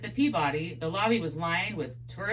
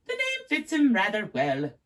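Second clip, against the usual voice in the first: put-on Englishman in a raised British accent; 3 semitones higher, 4 dB louder than usual. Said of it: "my accent's horrible".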